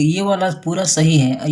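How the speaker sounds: ordinary talk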